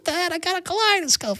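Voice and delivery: high, soft mock child voice; little kid. Kid showing dad a new toy, high soft child voice. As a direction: pipe up excitedly like a small child. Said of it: "The kids, when they're little".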